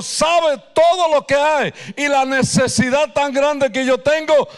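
fervent prayer. Acted. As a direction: pour out the heart, rising to a full-voiced plea. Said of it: "dile al Señor".

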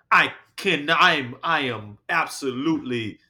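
mock shock. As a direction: ham up the shocked indignation of a woman.

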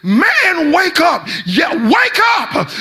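high shouted call to wake him; yelled warning. Retold or imitated. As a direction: yell across the lane at a sleeping driver. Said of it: "rolled down my window and yelled".

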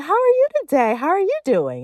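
loud, high, toothy-smile hello; re-enacted over-cheery greeting; high-energy greeting. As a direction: beam it at the grumpy host. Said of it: "which is a lot of energy".

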